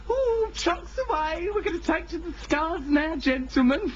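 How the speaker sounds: high hammed-up aviator call; camp pilot. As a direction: swoon as a dashing pilot. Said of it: "How'd you have responded".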